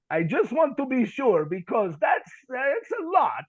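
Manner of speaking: put-on slow, high, worried Ukrainian accent; thick accent. Interpreter double-checking a big prophecy, hesitant Ukrainian accent. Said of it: "he has a real thick accent".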